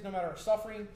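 ordinary talk, nothing marked

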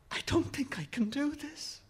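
high, soft, fearful confession; frightened boy losing his nerve; panicky. A narrator's reading. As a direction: say it small and high, holding back panic. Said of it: "the panic rising in him".

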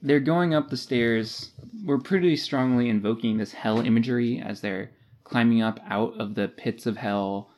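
ordinary talk

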